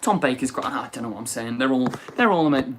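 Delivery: ordinary talk, nothing marked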